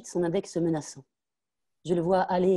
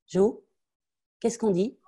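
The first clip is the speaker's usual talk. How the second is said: stern mother prompting; 5 semitones higher, 4 dB louder, slower than usual. Acted. Mother prompting child to thank.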